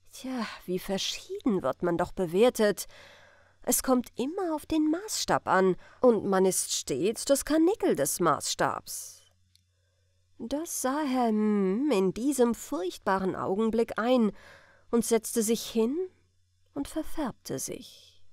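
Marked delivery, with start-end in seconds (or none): none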